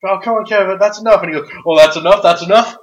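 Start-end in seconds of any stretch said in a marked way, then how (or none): 0.0-1.2 high, loud pleading referee; pleading ref. Retold or imitated. Referee begging Kevin not to attack, pleading. 1.6-2.8 loud, high taunting yell; mocking yell. Kevin taunting the ref before attacking, yelling. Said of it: "he just yells".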